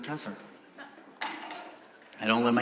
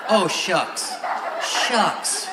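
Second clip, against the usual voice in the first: prim minced oath; 5 semitones higher, 7 dB louder, much slower than usual. Retold or imitated.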